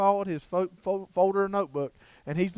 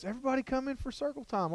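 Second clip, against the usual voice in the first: higher, singsong kindergarten-teacher call; sweet teacher call; 8 semitones higher, 7 dB quieter than usual. Retold or imitated. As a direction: call the kids over like a bright teacher. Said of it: "and the teacher goes".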